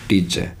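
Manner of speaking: टीचर as "tee-che", no R; silent final R. Shown as a demonstration. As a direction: close टीचर on an open "eh". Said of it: "आर का उच्चारण नहीं होगा".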